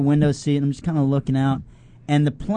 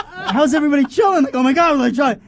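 much higher, louder, frantic; mock panic; 10 semitones higher, 5 dB louder, faster than usual. He tells friends how he panicked on the flight. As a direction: squeal in disbelief.

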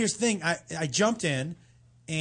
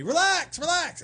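calling out; 11 semitones higher, slower than usual. Retold or imitated. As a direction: shout it across the water to calm him. Crew screaming while coming over.

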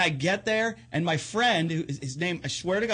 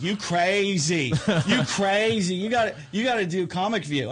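imitated excited fans; 4 semitones higher, 4 dB louder than usual. Girls cheering a comic offstage, excitedly egging him on. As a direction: draw out CRAZY, higher and louder.

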